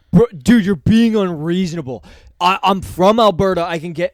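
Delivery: put-on loud, high drunk protest; drunk yelling. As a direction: yell at the bouncer like a drunk kid. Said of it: "And he goes".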